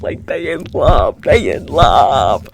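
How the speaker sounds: low, loud and drawn-out gushing. Real, not acted. Fan gushing that the two characters are in love, loud and slow. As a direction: lean into it like a lovestruck fan. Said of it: "emphatic gushing".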